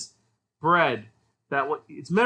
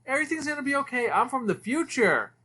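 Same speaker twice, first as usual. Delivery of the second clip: high, squeaky cartoon cat voice; a high, bubbly cartoon cat delivering bad news brightly; cartoon robot cat; 9 semitones higher than usual. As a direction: chirp it like a cheerful cartoon robot. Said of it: "a blue cat who says".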